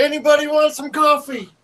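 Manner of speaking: high hammy New York voice; New York accent. Retold or imitated. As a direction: push the cawfee even harder. Mocking Joe's coffee message.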